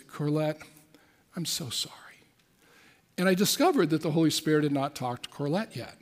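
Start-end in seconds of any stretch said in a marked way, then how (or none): 0.1-1.9 soft apology. Retold or imitated. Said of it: "I said".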